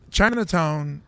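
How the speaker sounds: usual speaking voice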